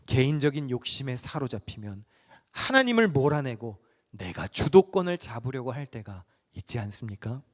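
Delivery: usual speaking voice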